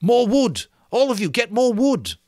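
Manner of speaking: put-on high, loud command to the boys; shouting. Ralph ordering the boys to fetch wood, loud shout. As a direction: yell it out so everyone runs. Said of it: "Ralph shouted".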